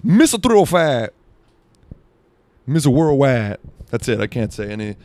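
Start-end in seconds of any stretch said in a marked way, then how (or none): 0.0-3.6 high, loud hype-man shout of his nicknames; loud Miami rapper tagline. Pretend. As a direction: announce yourself like a rap star. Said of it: "Just me doing [name]".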